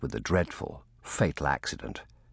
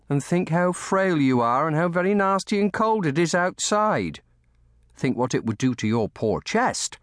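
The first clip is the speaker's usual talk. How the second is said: high-pitched, urgent scolding; alarmed nursemaid; 11 semitones higher, 4 dB louder than usual. A narrator's reading. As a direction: scold out of worry for a frail child. Lucy scolds, stricken by threat.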